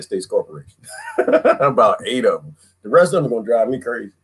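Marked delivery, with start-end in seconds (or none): none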